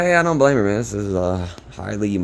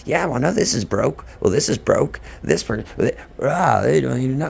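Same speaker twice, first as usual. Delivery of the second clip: higher-pitched mock complaint; imitated rude complaining; 6 semitones higher, 4 dB louder than usual. Retelling angry buyers in the yard, imitated rude complaining. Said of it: "really mean and rude at first".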